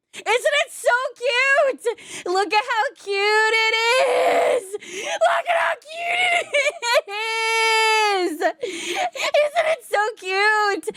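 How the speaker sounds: drawn-out high cooing; gushing squeal. Acted. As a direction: coo and squeal over something adorable. Gushing over the artwork.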